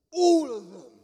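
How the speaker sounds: sudden loud, high outburst; imitated exasperated shout. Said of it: "she just shouted a bit".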